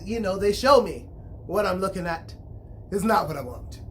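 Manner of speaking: usual speaking voice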